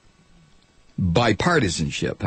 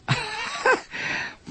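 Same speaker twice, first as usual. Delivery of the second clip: laugh; 6 dB quieter than usual. Real, not acted. Laugh after mocking blogger.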